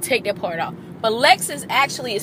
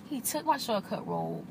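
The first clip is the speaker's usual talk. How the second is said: quiet mutter; 12 dB quieter than usual. Real, not acted. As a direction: mutter "He took my shortcut road" under the breath. Repeats line, second quiet.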